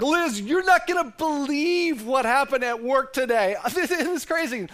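high, loud, excited Zach; hammed-up giddy husband bursting with news; high-pitched gushing husband. Husband Zach acted in a retold dialogue.

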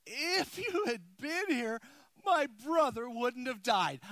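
high, slow, pleading cry of a grieving sister; Mary pleading. Acted. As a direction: plead with him through grief, high and slow. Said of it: "she fell at his feet and she said".

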